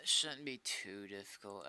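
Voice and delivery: ordinary talk, nothing marked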